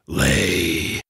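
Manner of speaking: low, quiet ogre grunt; ogre command. Acted. The ogre commanding the hen, low and soft. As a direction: growl one word like a giant.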